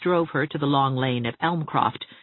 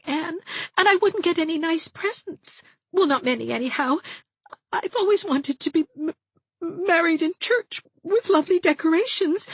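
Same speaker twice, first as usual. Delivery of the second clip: very high voice, stammering through tears; tearful Dovey, self-pitying. A narrator's reading. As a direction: whimper out excuses, catching on words.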